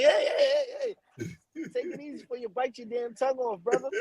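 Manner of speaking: high fast hey hey, take it easy; mock calming. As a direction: soothe an overexcited kid, quick and high. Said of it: "I'm like".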